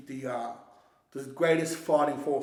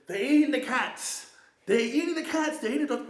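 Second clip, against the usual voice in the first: mock-quote a debate line, pitched way up; Trump; 9 semitones higher than usual. Retelling the debate to a mate, high-pitched Trump imitation. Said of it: "He goes like this, he goes".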